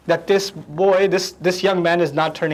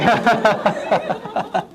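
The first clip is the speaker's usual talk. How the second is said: real laugh; 3 semitones higher than usual. Real, not acted. Laughing at parent threat.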